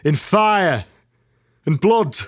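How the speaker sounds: grave, building theatrical speech; dramatic monologue. Acted.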